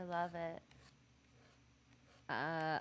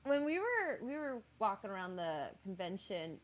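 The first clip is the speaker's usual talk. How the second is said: normal talking voice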